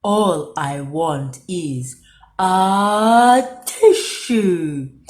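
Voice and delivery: slow, loud snuffly plea; stuffy wolf. A narrator's reading. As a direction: drag it out through a blocked nose. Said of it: "sniffed Big Bad".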